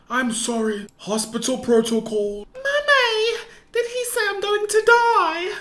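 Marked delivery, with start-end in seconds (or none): 2.5-5.5 put-on frightened child. Dying child asks mother.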